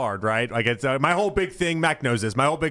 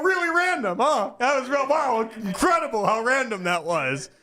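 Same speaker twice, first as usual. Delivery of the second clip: high, mock-amazed sarcasm, pitched up; sarcastic; 9 semitones higher than usual. Retelling his snark at the TSA agent, high mock amazement. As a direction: lay the sarcasm on thick about the random check. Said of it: "I go".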